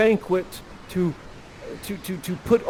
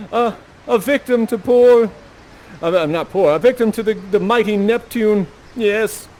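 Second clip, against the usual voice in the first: louder, higher anguished lament; grieving king; 7 semitones higher, 6 dB louder than usual. Acted. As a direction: wail for a drowned son, a touch hammed. King grieving his son.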